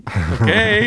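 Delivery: high, loud, drawn-out okay; bemused going along. Retold or imitated. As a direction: give in with comic bafflement. Reacting to band not stopping.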